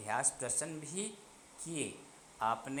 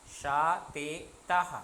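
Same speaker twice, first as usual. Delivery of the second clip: slow pronoun drill; 2 semitones higher, 6 dB louder, slower than usual. Shown as a demonstration. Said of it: "महिलाः बहुवचन है".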